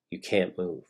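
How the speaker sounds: usual speaking voice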